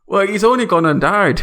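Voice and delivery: hammed-up Canadian friend greeting; Canadian accent. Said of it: "This is perfect Canadian accents".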